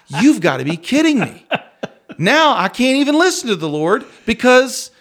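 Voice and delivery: high, loud exasperated rant, reliving the anger; retold angry outburst. Retelling the prayer meeting to the interviewer, exasperated and loud.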